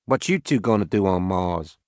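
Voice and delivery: put-on easygoing Arkansas farmer, Southern accent. Max to the Watanabes, a light Southern accent. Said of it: "He had a heavy southern accent".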